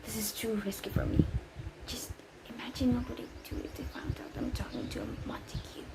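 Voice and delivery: a fast, unvoiced whisper. Nanny hiding her call from the household, whispering fast. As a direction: keep it hushed so nobody overhears.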